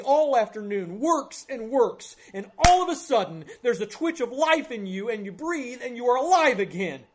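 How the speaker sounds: loud, high, building story of a rescue; impassioned preaching. Real, not acted. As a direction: drive the sermon story up to a shouted climax.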